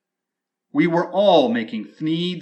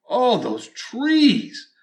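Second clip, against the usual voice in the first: slow, higher and fuller, yearning; swelling longing; 6 semitones higher, much slower than usual. A narrator's reading. Storyteller yearning aloud for the trees, higher and slow. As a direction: let the longing swell up high.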